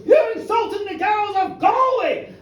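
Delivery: high, loud yelled retort; angry shout. Acted. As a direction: fling the insult straight back. Drunken quarrel, answering back.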